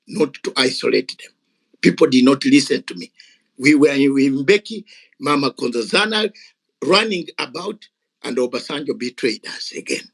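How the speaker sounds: normal talking voice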